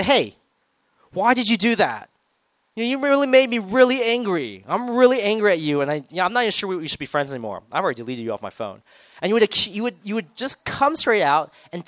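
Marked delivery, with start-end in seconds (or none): 0.0-8.8 louder, higher, heated telling-off; mock angry friend scolding; angry confrontation. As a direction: confront a friend who hurt you, voice raised. Confronting a friend who wronged you.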